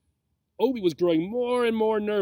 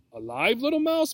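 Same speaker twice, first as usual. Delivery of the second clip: high-pitched, climbing alarmed question; horrified blurt; 6 semitones higher than usual. A narrator's reading. Obi hears what the snake eats, horrified. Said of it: "blurted out Obi, horrified".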